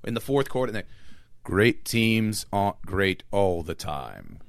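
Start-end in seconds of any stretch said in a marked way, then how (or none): 1.4-4.4 much lower and very slow, solemn; dramatic narrator. Retold or imitated. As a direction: intone like an epic football film. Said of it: "The line that stuck in my head".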